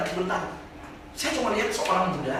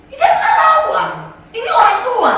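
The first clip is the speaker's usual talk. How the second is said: much higher and louder, indignant; preacher exclaiming that the old man never fought back; outraged exclaim; 12 dB louder than usual. Retold or imitated. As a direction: cry out in disbelief at the injustice. Outrage at the beating.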